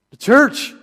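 loud and high, calling to the crowd; preacher calling out for a shout back; called out loud. Shown as a demonstration. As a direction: call it out to the congregation. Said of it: "Shout it out".

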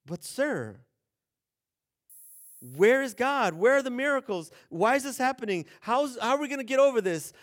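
high, loud pleading questions; anxious protest. Retold or imitated. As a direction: press heaven for answers. Gideon protests again.

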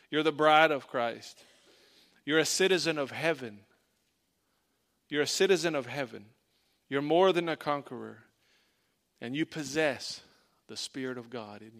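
declaring identity to the church, building in force; rousing preaching. Acted. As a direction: proclaim it to the congregation like a rally.

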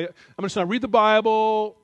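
loud, drawn-out mock declaration; hammed-up naive Bible reader; mock literal-minded resolve. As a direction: proclaim it slowly on a high, held note.

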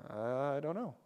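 low, quiet, hesitant mumble of not knowing; unsure Moses. Acted. Preacher as Moses asked about crossing the sea, hesitant and unsure. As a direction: answer as a clueless leader, voice dropped. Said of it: "Put yourself in Moses' shoes".

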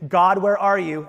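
loud pleading cry to God; voicing soldiers shouting up to God; desperate cry. Impatient people cry out.

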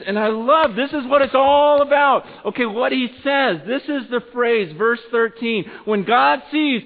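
high, excited sermon delivery; impassioned preaching. Real, not acted. Preacher telling how God responds to repentance, impassioned.

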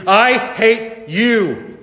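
high, very drawn-out cry, word by word; screamed hatred. A narrator's reading. Lily screaming at the killer who took her sisters, drawn-out cry. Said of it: "she screamed".

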